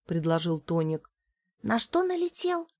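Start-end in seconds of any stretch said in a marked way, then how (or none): 1.6-2.7 high confused question; puzzled. Acted. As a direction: ask it baffled and high. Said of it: "не понял Петька".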